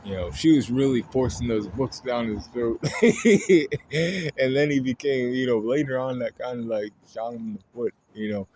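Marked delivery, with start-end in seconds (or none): 2.9-4.3 high burst of laughter; bright real laugh. Real, not acted. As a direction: laugh out loud at the joke. Laughing at own joke.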